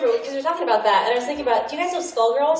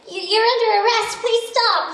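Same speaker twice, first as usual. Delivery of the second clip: much higher and louder, a timid officer; Noelle; 12 semitones higher, 4 dB louder than usual. Retold or imitated. Noelle tries to arrest Riven, much higher and louder. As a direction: squeak out the arrest, begging. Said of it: "And Noelle would be like".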